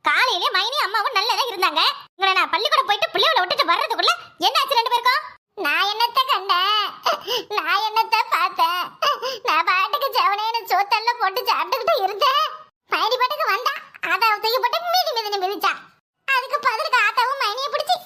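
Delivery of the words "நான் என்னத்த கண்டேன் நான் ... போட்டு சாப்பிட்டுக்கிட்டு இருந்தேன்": hammed-up crying; tearful self-defence. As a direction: wail your innocence through theatrical sobs. Tearful plea of innocence.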